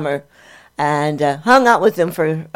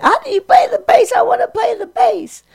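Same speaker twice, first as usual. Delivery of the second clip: much higher, pleading fan voice; eager young fan. Retold or imitated. Imitating his younger self begging a bass player for tips, much higher. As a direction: beg like a starstruck kid. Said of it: "I was saying".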